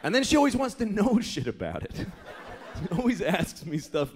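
ordinary talk

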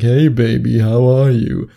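mock creep greeting, pushed out loud; creepy pickup line. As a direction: ham up a creepy come-on. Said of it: "That's creepy".

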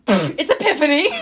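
high, loud, laughing correction; laughing outburst. Real, not acted. Host fumbling for the word with co-host, laughing outburst. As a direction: blurt it out through a laugh.

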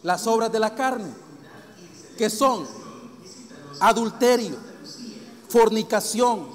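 loud, emphatic sermon delivery; forceful preaching. Real, not acted. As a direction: hammer each item of the list, loud and separate. Preaches a list of sins.

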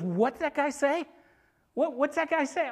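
high-pitched, startled demand; high, insistent questioning; incredulous outrage. Acted. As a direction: act out indignant disbelief. David outraged at the giant's insults.

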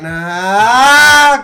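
un grito agudo de caricatura que se acerca; grito fuerte y alto como de dibujos animados; cartoon scream. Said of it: "como de esas de caricatura". (grito de caricatura imitado)

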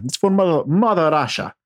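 mock-patriotic Russian plea; mock Russian accent. Boss urging Ivan to go, mock Russian accent.

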